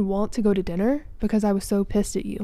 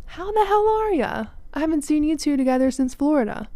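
much higher, booming buddy hello; boisterous guy greeting old pals; Jason's hearty greeting; 6 semitones higher than usual. Retold or imitated. Boyfriend snubbing her to greet his friends, hearty and much higher. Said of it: "He ignores me and turns to Ryan and Ethan".

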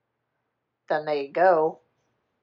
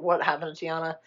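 normal talking voice

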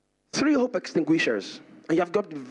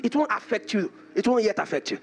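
ordinary talk, nothing marked

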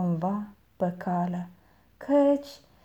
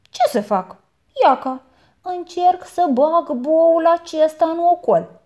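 put-on boy's voice; 6 semitones higher, 7 dB louder than usual. A narrator's reading. Boy answers aunt.

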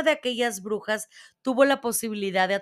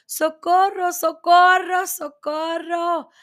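high, stretched, repeated cries for help; terrified screaming; 8 semitones higher, 7 dB louder, slower than usual. A narrator's reading. Trapped boy hoping someone hears him, screaming in terror. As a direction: keep screaming for rescue. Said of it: "me puse a chillar".

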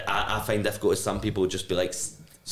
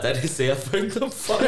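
higher, laughing deadpan jab; laughing quote; 7 semitones higher than usual. Retold or imitated. Retelling a fan's put-down to friends, higher and laughing. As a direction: quote her line while cracking up. Quoting a blunt fan.

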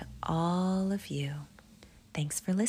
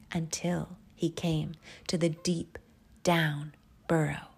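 usual speaking voice

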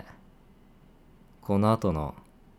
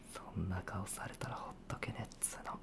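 quick, whispered grumble under the breath; muttered whisper; 16 dB quieter, faster than usual. Acted. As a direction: mutter it to yourself, almost inaudible. 相手の表情に独り言でぼやく. (小声でぼそっとつぶやく)

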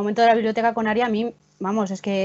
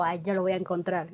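soft, lower smug imitation; mock him as full of himself; cocky mock voice; 4 semitones lower, 10 dB quieter than usual. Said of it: "Él todo chulo". (imitando a Bran chulo)

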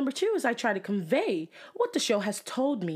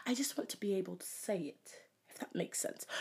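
whispering; 7 dB quieter than usual. Real, not acted. Wistful aside to listeners.

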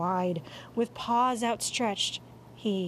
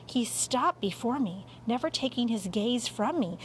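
usual speaking voice

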